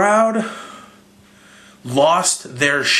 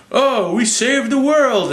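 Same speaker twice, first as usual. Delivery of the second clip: mock triumph; 9 semitones higher, slower than usual. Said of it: "then they're like".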